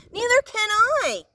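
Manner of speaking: high, loud howl. A narrator's reading. Flitter howling in fright while flying blind. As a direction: wail it in panic. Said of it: "howled Flitter".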